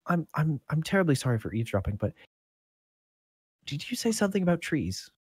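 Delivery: lightly voiced apologetic girl; young serving girl. Serving girl apologising for eavesdropping, lightly girlish and hesitant. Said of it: "younger girl. Uh, she's probably about sixteen".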